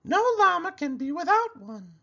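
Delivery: a llama giving friendly advice; Cherry Sue voice. A narrator's reading. Llama advising her friend the pig, high and sweet. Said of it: "she said".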